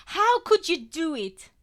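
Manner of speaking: loud, high, tearful outburst; angry through tears. A narrator's reading. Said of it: "I gabble angrily between tears".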